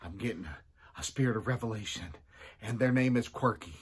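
usual speaking voice

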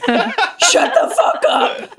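high, long drawn-out mock scream; hammed-up furious scream. Comedian re-enacting her bar fight with a guy, screamed. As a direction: scream him silent, playing it for laughs.

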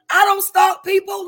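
loud, high protest; emphatic shout. Real, not acted. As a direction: hoog en luid uitroepen. Emphatic denial to audience.

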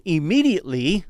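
"Immediately" hit high and loud; stress on Immediately. Acted. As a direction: lean hard on "Immediately". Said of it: "I love the next word".

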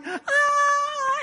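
high-pitched comic wail; mock crying wail. Mary Magdalene crying at the tomb, mock wail. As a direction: wail like a hammed-up sob. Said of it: "she's crying".

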